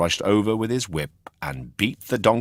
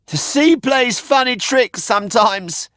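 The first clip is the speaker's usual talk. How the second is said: very high, louder cheeky puppet voice; a bright wooden puppet teasing; puppet boy voice; 7 dB louder than usual. A narrator's reading. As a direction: Pinocchio teasing the man who bought him. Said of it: "said Pinocchio".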